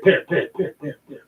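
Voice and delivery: words echoing away into silence; fading echo. Pretend. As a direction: let the word echo and die off. Echo on the title.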